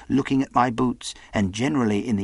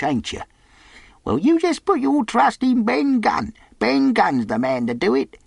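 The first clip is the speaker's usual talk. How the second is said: high-pitched, wheedling sailor; approving castaway; 10 semitones higher, 3 dB louder than usual. Acted. Castaway asks Jim about the squire's generosity, friendly and angling. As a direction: coax the boy in a high seadog character voice.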